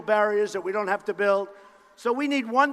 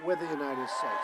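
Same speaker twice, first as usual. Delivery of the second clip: much lower and softer, an intimate echo; dropped low; 7 semitones lower, 9 dB quieter than usual. Real, not acted. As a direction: let it fall to a hush. Repeated slogan, dropped low.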